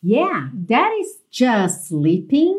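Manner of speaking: "just sleeping" joined by one long S; slow model, no T in "just"; linked S. Shown as a demonstration. Teacher modelling the lyric, "just sleeping" slowly linked. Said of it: "just sleeping também ficou ligadinho pelo S".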